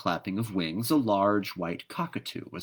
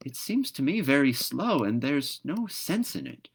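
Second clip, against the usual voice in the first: magpie voice; 7 semitones higher than usual. Acted. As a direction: say it like a puzzled bird. Magpie talks.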